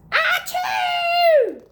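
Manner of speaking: loud, high acted sneeze; big sneeze. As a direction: let out a huge sneeze. The flea sneezes.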